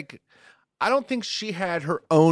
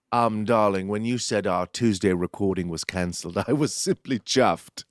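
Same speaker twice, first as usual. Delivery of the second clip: lower-pitched hammed-up English accent; put-on English accent; 4 semitones lower than usual. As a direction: say it as a languid English darling. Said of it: "In an accent".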